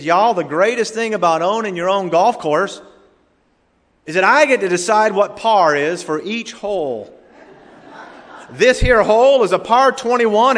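loud, high-pitched hillbilly twang; Jethro, thick hillbilly accent. Acted. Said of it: "he says in that very thick accent".

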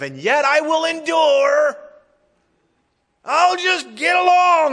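high, loud, slow mock declaration; mocking wrong version. Preacher mocking the wrong reading of the verse, high mock shout. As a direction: ham up a grudging half-hearted resolve. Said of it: "That's not what he said".

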